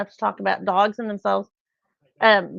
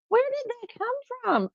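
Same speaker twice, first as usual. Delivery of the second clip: very high, softer incredulous squeak; exasperated astonishment, pitched way up; mock disbelief; 5 dB quieter than usual. Retold disbelief at dog hair.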